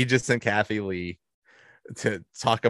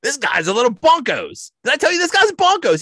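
much higher and louder, a fast excited talk-show host; Regis impression; 7 dB louder than usual. Regis hypes his guest on live TV: excited host impression. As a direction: ham up a giddy TV host's catchphrase. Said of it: "Regis keeps saying".

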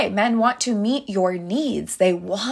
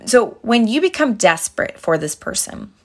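ordinary talk, nothing marked

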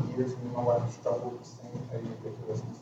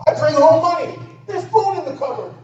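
loud, high-pitched defensive outburst; acted indignant yelling; indignant marital shout; 14 semitones higher, 11 dB louder than usual. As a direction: shout it as an aggrieved husband defending himself. Acted marital quarrel, husband shouting back.

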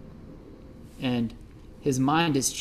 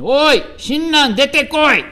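angry shout; 10 semitones higher, 8 dB louder than usual. Retold or imitated. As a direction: yell at the door to come out. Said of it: "the voice of Ben".

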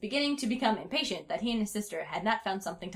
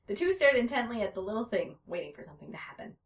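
usual speaking voice

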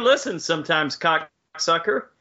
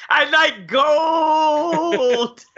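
silly shout; 7 semitones higher, 3 dB louder, much slower than usual. Pretend.

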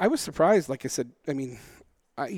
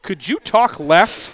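very slow, louder and higher; mock request; 4 semitones higher, 7 dB louder, much slower than usual. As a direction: spell out the plea slowly, with pointed emphasis. Mock request to the talkative man.

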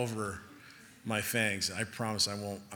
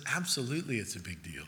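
God's quiet answer; 3 semitones lower, 6 dB quieter than usual. Retold or imitated. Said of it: "And God says".

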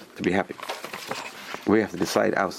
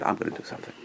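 resolve; 9 dB quieter, faster than usual. Retold or imitated. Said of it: "He said".

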